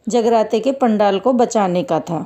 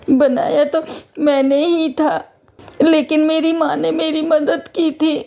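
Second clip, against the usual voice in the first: put-on higher, subdued child voice; sad orphan girl; 5 semitones higher than usual. Orphaned girl tells the guard who made the prasad, sad. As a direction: answer as a small, sorrowful girl.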